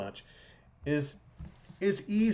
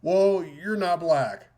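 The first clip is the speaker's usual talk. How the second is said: slow, loud, dismissive jab; mock Trump quote; 3 semitones higher, 6 dB louder, slower than usual. Host mimicking Trump to co-host, slow and loud. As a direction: drag out a flat dismissive verdict. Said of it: "So what do you do?".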